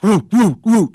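high, loud dog woofs; joke-telling dog bark, high and loud. Acted. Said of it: "And the dog goes".

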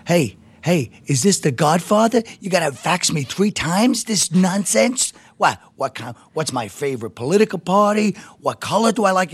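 mock outraged comedian, pitch pushed up; hammed-up indignant ranting; imitating angry rant. Comic retelling his colleague's rant about faxes, mock outraged.

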